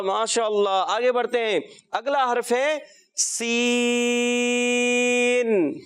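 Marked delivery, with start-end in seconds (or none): 1.9-5.8 سین held very long, the vowel stretched; سین chanted out slowly and long; drawn-out seen. Shown as a demonstration. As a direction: stretch the ee in سین for three counts. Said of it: "تین الف".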